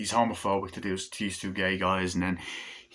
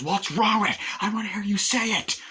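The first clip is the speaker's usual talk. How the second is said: mock shout; 13 semitones higher, 4 dB louder than usual. Man yells at worker after blast.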